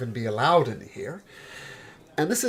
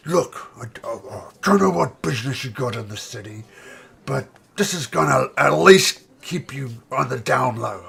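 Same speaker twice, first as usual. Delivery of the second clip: ogre voice; 6 dB louder than usual. Acted. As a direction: growl it out like a big ogre. [name] keeps the ogre voice.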